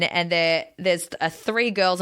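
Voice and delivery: usual speaking voice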